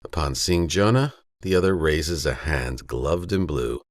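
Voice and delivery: ordinary delivery, nothing marked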